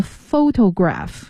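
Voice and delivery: photograph slowly, first syllable PHO stressed; PHO-to-graph, drawn out for learners. Shown as a demonstration. Teacher going back over the word, photograph slow and stressed on PHO. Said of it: "也是重音在第一个音节fo".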